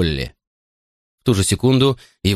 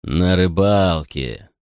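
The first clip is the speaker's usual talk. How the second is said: drawled; much slower than usual. Acted. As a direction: stretch it out slowly, mocking. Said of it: "протянул Гарсия".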